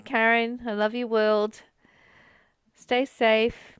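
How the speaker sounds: ordinary talk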